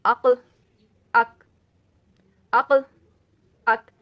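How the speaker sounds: عقل thick and bouncing, اک thin and stopped; heavy and light pairs. Shown as a demonstration. Teacher drilling the contrast for students, loud. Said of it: "انہیں اس طریقے سے پڑھا جائے گا".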